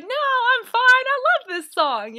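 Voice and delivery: mom on the dance floor waving off her friends, high and loud; carefree mom. Retold or imitated. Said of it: "she was like".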